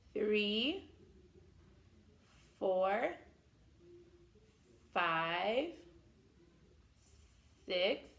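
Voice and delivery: slow drawn-out counting, each number rising; kids show host. Acted. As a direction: count slowly with the children.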